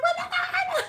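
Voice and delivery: very high squeal breaking into laughter; acted panic. Retelling being chased by the cat, high panicked squeal. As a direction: shriek it in mock terror, laughing.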